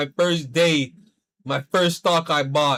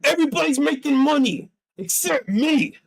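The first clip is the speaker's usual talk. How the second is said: high, whining mock self-pity; whiny complaint; 8 semitones higher than usual. To himself, left out of the rally, mock whine. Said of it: "I was like".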